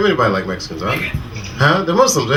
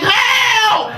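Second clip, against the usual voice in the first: high, shrill-free shouted help; mock scream for help. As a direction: yell help like a panicked man. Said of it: "He was like".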